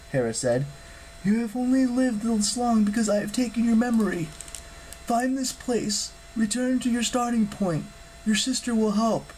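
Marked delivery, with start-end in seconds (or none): 1.2-9.3 high-pitched, earnest goddess voice; urgent divine pronouncement, high throughout; solemn goddess. Acted. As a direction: proclaim a grave truth in a raised pitch. Hera instructs Jason.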